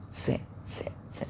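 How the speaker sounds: س whispered as a hiss. Shown as a demonstration. Teacher isolating the s sound for students. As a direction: let the س hiss out. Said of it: "كأن ت وسين".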